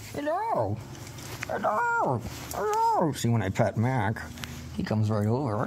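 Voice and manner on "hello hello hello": very high, drawn-out hellos; high greeting. Real, not acted. Greeting the puppy in a very high sing-song.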